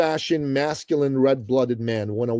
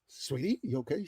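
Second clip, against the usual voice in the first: acted soft, concerned check-in; hushed voice, gently questioning; worried mother; 12 dB quieter than usual. Mother checking on her silent son, retold with soft worry. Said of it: "to the point where she got worried".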